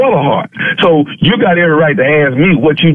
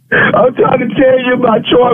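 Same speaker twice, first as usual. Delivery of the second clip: crying on the phone; 8 semitones higher than usual. Acted. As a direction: voice pitched up, fake crying. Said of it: "are you crying, man?".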